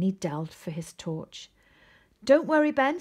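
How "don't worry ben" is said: loud, much higher bold call; brave reassurance. A narrator's reading. Arthur reassures frightened Ben, loud and brave. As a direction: sound braver than you feel.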